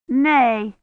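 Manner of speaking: put-on high, stubborn little-girl refusal; childish, obstinate no. A narrator's reading. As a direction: dig your heels in like a child. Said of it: "sounding almost as stubborn as her sister".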